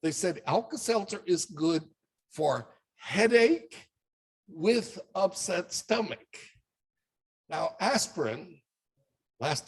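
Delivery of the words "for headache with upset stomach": for headache said slowly and stressed, with upset stomach drawn out; slow, pointed emphasis. Shown as a demonstration. Lecturer slowly stressing the ad's hidden claims to students. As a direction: spell the two claims out slowly for the class. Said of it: "Did you notice two things in there".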